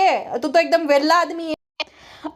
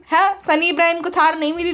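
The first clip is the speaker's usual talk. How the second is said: ordinary delivery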